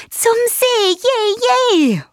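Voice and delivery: high-pitched gleeful bird; happy cheer. A narrator's reading. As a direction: cheer joyfully, high and bright-eyed. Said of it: "ruft Froni fröhlich".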